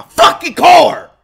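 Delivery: sudden loud burst on the curse; exasperated outburst. Real, not acted. Streamer insisting it is not his car, exasperated shout. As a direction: blurt it out in protest.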